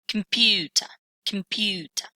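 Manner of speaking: "Computer" stressed firmly on PU, falling away after; stress on second syllable. Shown as a demonstration. Teacher modelling primary stress on the second syllable, "Computer" stressed on PU. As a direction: say "Computer" as com-PU-ter, weak first and last syllables. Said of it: "primary stress on the second one".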